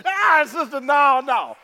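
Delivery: high, loud cry of objection; loud protest. Pretend. Preacher cutting off a lamenting sister, loud protest. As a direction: burst in to stop the lament.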